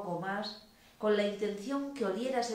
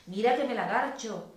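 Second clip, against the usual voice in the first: emphatic repeat; 3 semitones higher, 6 dB louder than usual. A narrator's reading. Lover repeating the arousing phrase at the ear, louder and emphatic. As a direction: say it with more force and lift. Same line repeated with more force.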